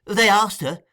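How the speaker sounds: high, loud reply; Noah, reporting. A narrator's reading.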